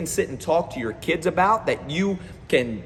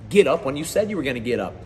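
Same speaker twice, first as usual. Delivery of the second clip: ordinary delivery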